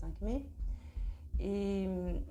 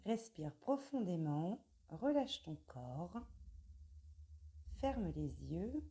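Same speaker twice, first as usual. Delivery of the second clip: guided meditation; 5 dB quieter, slower than usual. Acted. Guides the breathing, eyes closed.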